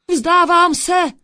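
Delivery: high, loud, drawn-out surrender; cry of mortal terror. A narrator's reading. Thief attacked in the tree surrenders, terrified cry. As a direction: cry out as if in fear for your life. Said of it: "vykřikl zloděj v smrtelné úzkosti".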